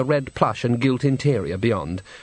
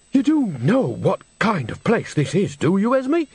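higher, pointed and peeved question; annoyed; 8 semitones higher than usual. Acted. Nanny questioning Granny about the brothel, peeved and higher. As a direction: press her with peevish disbelief.

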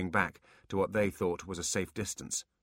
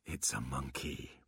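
weary sigh, soft and slow; 5 semitones lower, 9 dB quieter, slower than usual. Acted. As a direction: sigh the words out tiredly. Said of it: "Quan sighed".

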